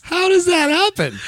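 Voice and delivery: high, slow disbelief; baffled, voice jumps way up; incredulous squeak. Real, not acted.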